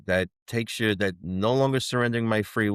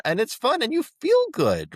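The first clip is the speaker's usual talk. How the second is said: excited; 13 semitones higher, 3 dB louder than usual. Real, not acted. Excited about feeling good.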